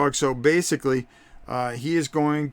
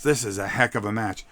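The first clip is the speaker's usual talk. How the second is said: ordinary talk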